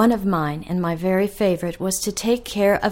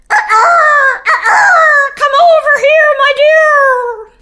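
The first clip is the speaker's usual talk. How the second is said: much higher, louder rooster calling his hen; 9 dB louder, slower than usual. Acted. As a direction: crow it out like a proud rooster. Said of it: "He would say things like".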